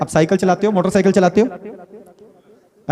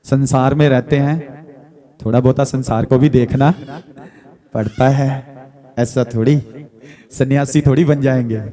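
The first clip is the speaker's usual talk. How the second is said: lower-pitched mimicry of objecting churchgoers; mimicked objection; 5 semitones lower than usual. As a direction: voice their protest in a low register. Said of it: "और कहते हैं". (नीची आवाज़ में टोकने वालों की नकल)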